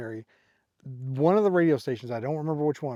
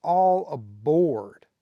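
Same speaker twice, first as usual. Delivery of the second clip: slow, drawn-out, louder "All aboard"; rock singer call; 6 dB louder, much slower than usual. Retold or imitated. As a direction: stretch out "All aboard" like the song intro. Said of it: "He's saying".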